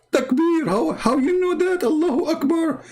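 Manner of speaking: high squeaky indignant voice; mocking caricature. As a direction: parody an outraged believer.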